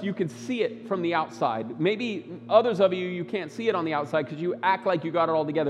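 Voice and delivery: usual speaking voice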